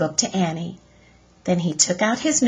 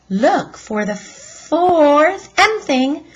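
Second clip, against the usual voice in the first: bouncing up and down; 7 semitones higher, 5 dB louder, slower than usual. Acted. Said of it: "bouncing up and down".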